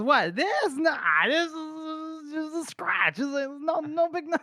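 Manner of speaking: squeaky, high-pitched denial; hammed-up falsetto brush-off; barely holding together. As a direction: squeal it, drawn out, in mock reassurance. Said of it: "not holding it together".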